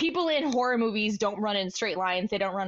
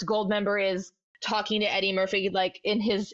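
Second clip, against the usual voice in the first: ordinary talk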